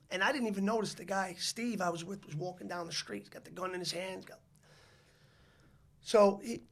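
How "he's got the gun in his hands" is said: ordinary delivery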